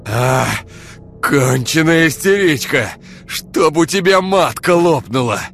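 high, loud, slow yell of pain and rage; scratched man yelling curses at her. Acted. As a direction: bellow through the pain. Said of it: "орал от боли Олег".

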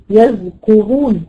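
slow recitation of يذكرون; tajweed reading. Shown as a demonstration. Teacher recites the word during a tajweed lesson. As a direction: draw out يذكرون evenly.

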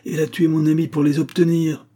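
loud, high-pitched charge of murder; heated accusation. Acted. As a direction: hurl the accusation. Accusing Rosa of murder in quarrel.

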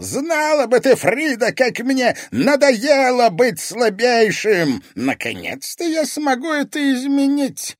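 high, loud villain's exultation; elated shouting, released at last; rapturous outburst. A narrator's reading. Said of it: "восторженно воскликнул мастер зеркал".

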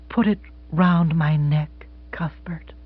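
soft and slow, name breathed unvoiced; tender whispered request. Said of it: "she whispered".

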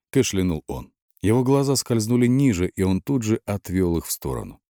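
usual speaking voice

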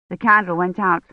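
ordinary delivery

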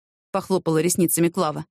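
usual speaking voice

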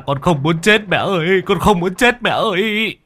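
high, pleading cry of a man being strangled; desperate pleading. Acted. Man begging the ghost mother, terrified pleading. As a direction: beg for your life in terror.

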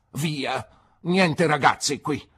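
loud, slow, emphatic shout. Acted. Aunt chasing a boy out of her garden, slow loud shout. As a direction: drive the boy away with a slow yell. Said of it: "gridò Miss Betsy".

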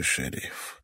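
breathy whisper, fading out. Acted. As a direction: let the word die on the breath. Dying old man fading.

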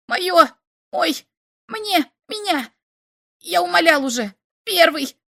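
high, loud breathless yelling; choking shout. A narrator's reading. Slop yells at the rival alien over Igor's body, frantic. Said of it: "захлёбываясь, кричал Слоп".

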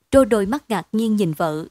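ordinary delivery, nothing marked

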